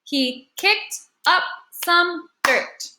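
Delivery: deliberate beat-by-beat read; clapped-out syllables. Shown as a demonstration. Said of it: "check line four now and make sure".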